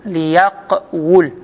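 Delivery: ليقول very slowly as li-yaq-wul, split after yaq; underlying form. Shown as a demonstration. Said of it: "والأصل".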